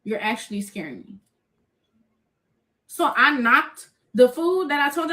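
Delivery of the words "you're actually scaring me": low, hushed retold fear. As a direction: quietly replay telling him you're frightened. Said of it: "I'm fearing for my life".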